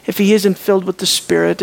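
ordinary delivery

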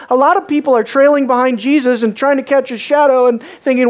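usual speaking voice

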